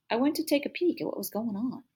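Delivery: ordinary delivery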